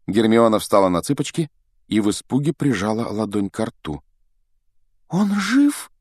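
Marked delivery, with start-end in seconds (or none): 5.1-5.9 thin, high, hushed question; frightened whispering. A narrator's reading. Girl fearing for the fallen teacher, frightened hushed question. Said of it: "прошептала она".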